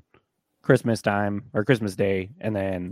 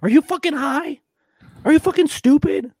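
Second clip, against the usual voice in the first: high shrill mocking echo, much louder; mocking squeaky parrot; 8 dB louder than usual. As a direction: squeal it back as a mimic.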